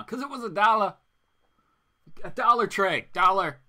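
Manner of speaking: "dollar" loud and leaning; emphatic. Real, not acted. Host marveling at the one-dollar price, emphatic. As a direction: punch "Dollar" hard.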